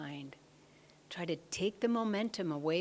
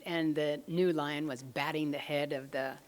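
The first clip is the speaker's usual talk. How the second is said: usual speaking voice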